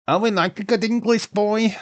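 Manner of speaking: high, loud mock-female cooing; imitated drunk women. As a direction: put on a squealing flirty hen-party voice. Voices drunk women in the chat.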